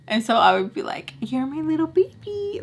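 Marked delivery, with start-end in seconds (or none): 1.2-2.6 put-on high, cooing baby talk. Teasing the boyfriend on the phone in baby talk. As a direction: coo it like to a toddler. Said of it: "Like that, okay?".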